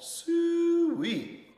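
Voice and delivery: very high, long-held sooey; full-voiced, drawn-out farm call; loud hog call. A narrator's reading. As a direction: holler for the hogs across a fairground. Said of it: "Holler was so loud".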